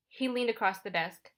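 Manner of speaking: ordinary delivery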